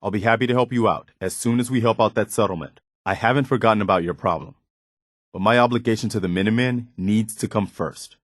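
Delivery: ordinary delivery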